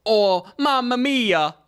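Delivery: slow, high mamma mia; quoted exclamation. Retold or imitated. Repeating the manga's line for a co-host, drawn-out exclamation. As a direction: stretch it out high and hammy. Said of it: "It says".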